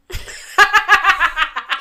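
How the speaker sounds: big burst of laughter; loud real laugh after her own joke. Real, not acted. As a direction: really crack up.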